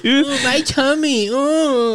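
high, slow whimper over a sore tummy; hammed-up pitiful groan; whiny tummy ache. Mocking a friend with a sore tummy, high whiny whimper.

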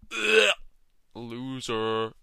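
mock-sneer at the sister's name; disgusted scoff.

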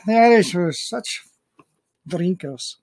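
jokey Irish brogue; Irish accent. Pretend. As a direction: tease in a mock Irish accent. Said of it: "You can be Irish".

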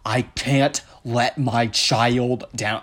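slow, halting dopey politician voice; ponderous, put-on dim voice; mock politician. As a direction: plod through it, word by word. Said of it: "Ted Cruz was like".

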